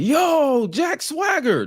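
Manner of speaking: high-pitched excited yell; all-caps excitement. Retold or imitated. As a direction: shout it like an all-caps tweet. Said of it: "whole excited, all caps".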